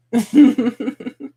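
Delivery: bright rising laugh; a loud burst of laughter. Real, not acted. Laughs at yawn teasing.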